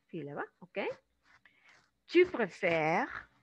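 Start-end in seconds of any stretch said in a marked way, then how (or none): 2.1-3.3 "Tu préfères" slow, ending left silent. Shown as a demonstration. Teacher showing second person, "Tu préfères" with unpronounced ending. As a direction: stretch "préfères" and stop on the r sound. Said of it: "no la estamos pronunciando".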